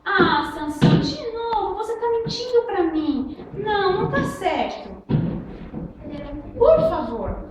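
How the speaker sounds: high, hurt whining plea; pleading Dalila. Acted. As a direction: nag him for the secret. Dalila reclama de novo. (Dalila choramingando em voz aguda)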